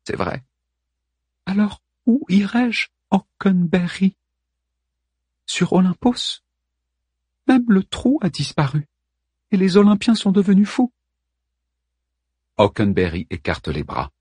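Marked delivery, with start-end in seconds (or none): 1.5-10.9 Helen's voice. Acted. Helen despairs.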